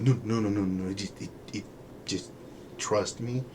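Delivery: soft, stumbling imitation of worker; hesitant reassurance. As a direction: backpedal softly, then coax. Acting worker hedging, urging trust.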